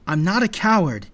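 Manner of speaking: indignant retort; defiant protest. A narrator's reading. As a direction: push back firmly. Defying order to flee.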